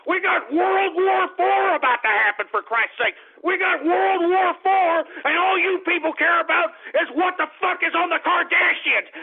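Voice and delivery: high-pitched, shouted tirade; angry rant. Real, not acted. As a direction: yell at the audience in exasperation.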